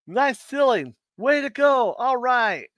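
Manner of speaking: high-pitched mock fan cheers; cheering fans. Ceiling fans praising the ceiling, mock cheering. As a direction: cheer like an over-keen admirer. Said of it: "all the time they say".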